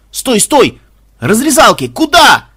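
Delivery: high, loud alarmed yell; panicked shout. Acted. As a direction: yell him back in panic. Gamer panicking.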